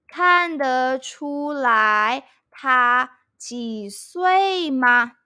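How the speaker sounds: slow, syllable by syllable 你看得出来她几岁吗; teacher modelling each syllable of 你看得出来她几岁吗 slowly; slow teaching demo. Shown as a demonstration. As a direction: spell it out slowly for a learner. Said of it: "You can say".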